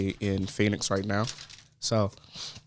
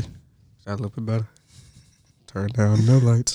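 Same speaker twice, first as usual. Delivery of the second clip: sexy voice. Pretend. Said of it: "Do I sound sexy?".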